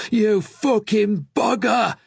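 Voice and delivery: very high, loud, slow enraged yell; patient screaming at attendant, furious; furious shout. Acted. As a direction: explode in rage. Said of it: "he shouted at Sam".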